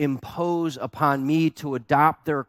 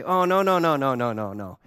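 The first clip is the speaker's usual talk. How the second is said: amused dismissal. Retold or imitated. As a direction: wave it off in one long falling run. Hosts laugh off the forks.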